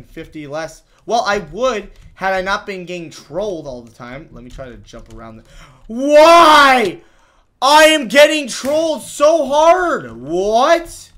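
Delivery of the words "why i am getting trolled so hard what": high, drawn-out wail; exasperated yell. Real, not acted. Gamer getting trolled by the map, exasperated yell. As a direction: howl it slowly in outrage.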